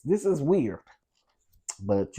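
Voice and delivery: ordinary talk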